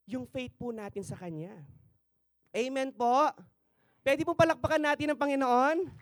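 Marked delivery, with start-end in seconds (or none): 2.5-5.9 high, loud rallying call; calling out to the church. Real, not acted. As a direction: lift the room into applause.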